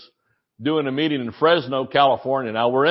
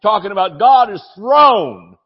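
full-force preaching; 10 semitones higher than usual. Real, not acted. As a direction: preach it out at the top of your lungs. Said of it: "I yell a lot when I preach".

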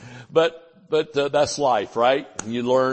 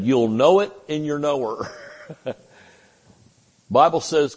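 ordinary delivery

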